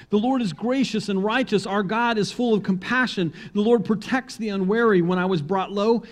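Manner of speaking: ringing scripture proclamation; fervent psalm reading. Acted. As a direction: lift the psalm up like praise. Declaiming psalm with fervor.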